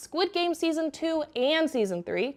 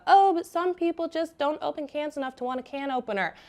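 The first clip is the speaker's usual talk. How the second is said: higher, whiny-leaning mock protest; mocking objector; 4 semitones higher than usual. Host parodies viewers defending the knife, mocking. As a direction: imitate someone making a feeble excuse. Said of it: "don't come at me with the".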